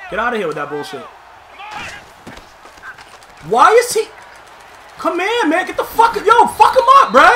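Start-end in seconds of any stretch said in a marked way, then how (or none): 3.5-7.3 much higher, loud cheering; real hyped shouting at the game; excited yelling. Real, not acted. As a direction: urge the player on, hyped. Excited play action.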